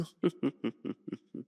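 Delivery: low, falling run of chuckles; sinister chuckle. Acted. Vampire threatening hunter's friends, low chuckle.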